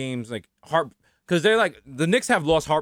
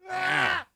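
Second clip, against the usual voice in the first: high, drawn-out mock cry; pitched-up groan of a hurting old man; old man's groan; 14 semitones higher, 6 dB quieter than usual. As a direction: let out a feigned wail of discomfort. Acts old man hurting in bed.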